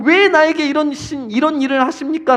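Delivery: protesting. Acted. Believer questioning God about hardship, protesting. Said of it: "라고 항변하고 계십니까?".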